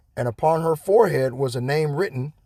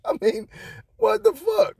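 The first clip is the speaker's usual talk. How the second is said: incredulous squeal. Real, not acted. Incredulous at story.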